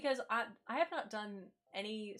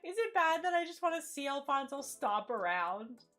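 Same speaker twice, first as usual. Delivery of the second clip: much higher and louder, squealing over something cute; gushing squeal; 11 semitones higher, 7 dB louder than usual. Real, not acted. Streamer gushing over a stomping villager, high squeal. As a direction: coo at a cute little creature.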